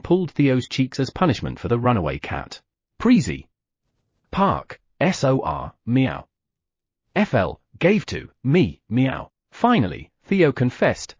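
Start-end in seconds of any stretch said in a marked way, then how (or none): 4.3-9.3 slow, halting letters "S O R" and "F L" spoken one by one; muddled spelling. Acted. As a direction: spell it out slowly and jumbled, like a cat confessing. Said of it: "his pronunciation was all muddled".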